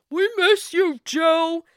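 mock call from the background, high and loud; Bill. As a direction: imitate a man calling out over the toast. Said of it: "you got Bill Eight in the background".

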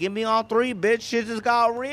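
high, hammed-up cocky outburst; mock swagger. Son tries catchphrase on mom, mock swagger. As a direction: blurt it proudly, stretching the last word.

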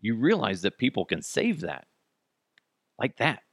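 ordinary delivery

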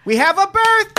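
very high, loud hammed-up holler; mock waitress announcement. Pretend waitress to the restaurant, high mock shout.

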